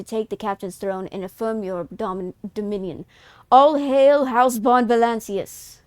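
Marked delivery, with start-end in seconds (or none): 3.5-5.8 slow, loud royal decree; grand proclamation. Pretend. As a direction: proclaim it to the court. Proclaiming to the ship's crew.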